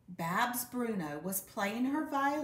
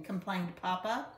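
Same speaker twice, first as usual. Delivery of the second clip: plain reading voice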